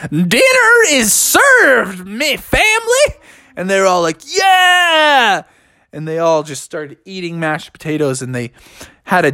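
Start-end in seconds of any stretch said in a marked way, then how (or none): 0.1-3.2 high, drawn-out yell to the family; very slow, high-pitched proclamation; Uncle Melvin. A narrator's reading. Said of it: "Melvin yelled out". 4.2-5.4 high, drawn-out whoop; high-pitched excited "yeah"; family cheering. A narrator's reading.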